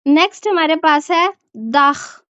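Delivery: ordinary talk, nothing marked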